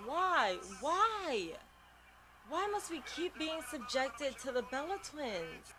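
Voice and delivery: high, drawn-out exasperated why; high, slow, fed-up protest; exasperated wail. Real, not acted. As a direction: moan at the screen in disbelief. Annoyed by the Bella Twins.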